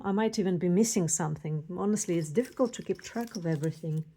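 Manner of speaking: rapid, rushed chatter; talking fast. Real, not acted. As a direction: rattle it off quickly. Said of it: "I'm sorry for talking so fast".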